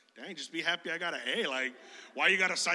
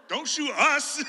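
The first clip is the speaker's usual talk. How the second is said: laughing plea; 6 semitones higher, 5 dB louder, slower than usual. Retold or imitated. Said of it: "I'm like".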